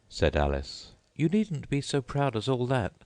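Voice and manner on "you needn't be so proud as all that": put-on much higher polite girl voice; Alice's voice. A narrator's reading. Alice thanking the Mock Turtle, high and polite. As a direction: pitch up into a polite young girl.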